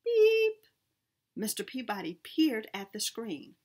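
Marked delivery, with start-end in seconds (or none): 0.0-0.7 high, loud beep sound; high electronic beep. A narrator's reading. Dashboard alert going off, a loud high beep. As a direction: chirp it like a dashboard alarm.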